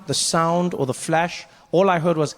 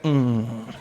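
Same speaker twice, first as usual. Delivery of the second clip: low drawn-out "mm"; low stifled grunt; 10 semitones lower than usual. Retold or imitated. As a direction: grunt it low, lips closed. Imitates people's grunt on impact.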